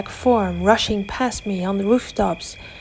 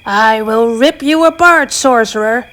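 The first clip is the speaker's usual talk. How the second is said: snarling werewolf; 2 semitones higher, 6 dB louder than usual. A narrator's reading. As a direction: spit the threat like an enraged beast. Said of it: "he let out a beastly snarl".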